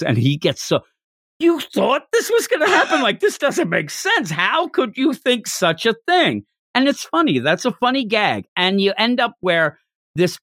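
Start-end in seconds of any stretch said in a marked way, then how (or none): 1.4-6.4 high, indignant disbelief, much higher pitch; a stuffy headmaster who can't believe it; outraged housemaster. Retold or imitated.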